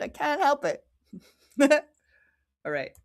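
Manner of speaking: usual speaking voice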